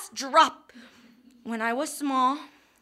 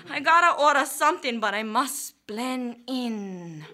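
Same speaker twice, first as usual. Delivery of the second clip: ordinary talk, nothing marked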